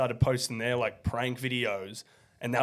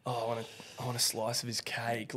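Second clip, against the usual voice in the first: hushed cheeky remark, much softer; quiet aside; 8 dB quieter than usual. Real, not acted. Office people nearby, cheeky aside.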